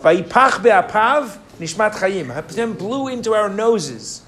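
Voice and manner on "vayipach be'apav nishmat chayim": recited verse. Shown as a demonstration. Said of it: "Anybody remember the pasuk?".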